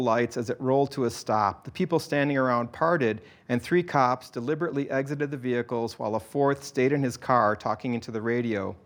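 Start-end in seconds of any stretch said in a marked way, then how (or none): none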